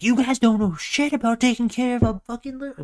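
a put-on, high griping Muscle Man; Muscle Man's voice. Muscle Man trapped in a necklace, griping high and whiny. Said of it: "he's like".

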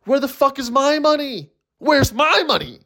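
badly put-upon high-pitched yelp; mock demand. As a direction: whine it out high, demanding the cash. Joking angry demand, then "kidding.

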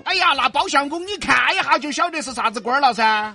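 loud Sichuan dialect query. Acted. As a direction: press him in Sichuan dialect.

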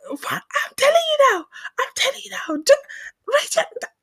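high, strained urgent command; scolding mother. Retold or imitated. Mother ordering screaming daughter to do it, scolding.

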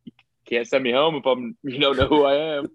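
a light put-on voice, defiant and smug; stubborn contestant voice. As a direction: play the guy refusing to leave the box, half laughing.

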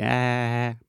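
sheep bleat. Retold or imitated. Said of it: "It's like a sheep".

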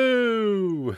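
high, drawn-out comic hello; Jerry Seinfeld. Acted. Guest performing the hello on request, comic impression. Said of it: "I hear the Jerry Seinfeld thing".

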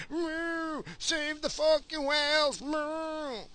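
high cow-like moo mixed with a chant; mock them as a lowing herd; mock mooing protester. Mocking the heifer brigade.

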